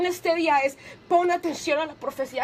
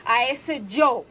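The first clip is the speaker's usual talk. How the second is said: slow, weighty reading of the verse; solemn scripture reading; 2 semitones lower, slower than usual. Acted. Preacher reading a psalm to the congregation, slow and solemn. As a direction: read each line gravely and unhurried.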